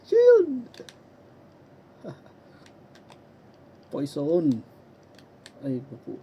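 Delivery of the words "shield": high, loud panicked shout. Real, not acted. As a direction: yell it in a panic. Panicked skill call.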